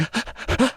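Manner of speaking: quick high, soft crying gasps; hitching mock sob breaths; imitated sobbing gasps. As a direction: mimic someone bawling. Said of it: "He is bawling, crying".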